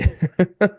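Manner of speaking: high, bright bursting laugh; sudden real laugh; burst of laughter. Real, not acted. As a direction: crack up at the news. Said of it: "I just bust out laughing".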